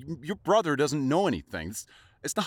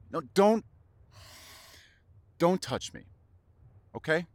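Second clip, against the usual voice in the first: angry refusal; 5 semitones higher than usual. Acted. Pushing lover away again.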